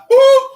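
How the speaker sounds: high, loud mock boo sounds; imitating boo. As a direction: squeak out the boos like the comedian. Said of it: "And she like".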